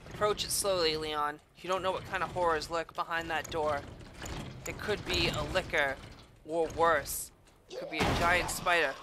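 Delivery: low, hushed horror-trailer voice; ominous trailer narrator. Acted. Gamer narrating to Leon, hushed and ominous.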